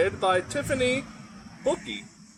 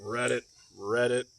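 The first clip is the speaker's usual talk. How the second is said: bullfrog croak; 7 semitones lower, 4 dB quieter than usual. Acted.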